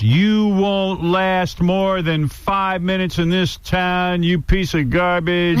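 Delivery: put-on a jealous old DJ tearing into a rookie; hammed-up angry put-down; mock angry rant. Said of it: "filled with anger".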